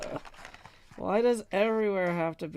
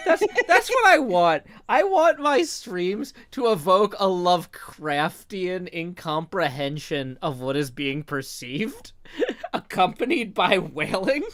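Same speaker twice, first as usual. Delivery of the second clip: gleeful excitement; 5 semitones higher, 9 dB louder than usual. Real, not acted. Gleeful about streams.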